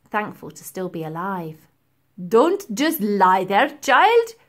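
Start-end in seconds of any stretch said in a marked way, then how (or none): 2.3-4.4 slow, loud call upward; calling professor. A narrator's reading. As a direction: call up to her sternly. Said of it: "the professor called up".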